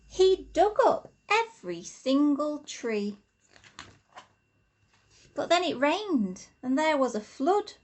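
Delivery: lively, rhythmic storybook reading; reading to children. Acted. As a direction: read the rhymes aloud to little ones, bouncing along. Reads a rhyming picture book aloud.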